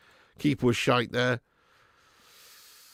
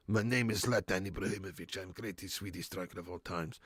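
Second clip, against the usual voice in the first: put-on low, soft Swedish-accented Zlatan voice; low, quiet Swedish accent impression; 8 semitones lower, 12 dB quieter than usual. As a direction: mimic Zlatan, the Swedish striker, softly and low. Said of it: "Do a Zlatan one".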